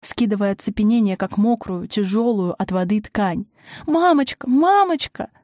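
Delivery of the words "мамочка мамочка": high, pleading wail; rising, high girlish cry; child's frightened cry. A narrator's reading. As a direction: beg your mother desperately. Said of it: "закричала Стежка".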